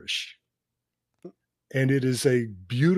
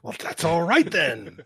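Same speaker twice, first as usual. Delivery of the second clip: laughing echo, a bit higher; echoing the catchphrase; 5 semitones higher than usual. Retold or imitated. Cohost echoes the villain line back, laughing. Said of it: "I loved that".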